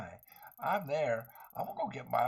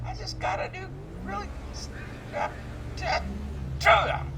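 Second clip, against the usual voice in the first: much higher, squeaky put-on whine; 6 dB louder than usual. Player whining about the new truck in a squeaky voice.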